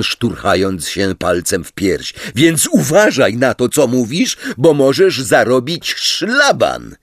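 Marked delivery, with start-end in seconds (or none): 2.3-7.0 higher, emphatic threat; heated, authoritative reprimand; angry warning. A narrator's reading. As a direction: lay down the law crossly. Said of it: "ryknął Ron".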